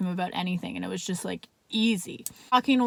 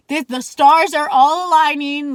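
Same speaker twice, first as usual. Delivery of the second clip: loud, slowed, higher-pitched amazement; thrilled; 5 semitones higher, 9 dB louder than usual. Retold or imitated. Woman amazed her night off lets her see this guy, thrilled. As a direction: marvel out loud at your luck.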